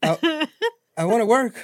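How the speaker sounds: high-pitched mock eager youngster; smiling eager kid. Teasing impression of young job seeker, smiling and eager. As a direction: imitate a grinning kid begging for work. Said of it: "Brian was just there, like, smiling".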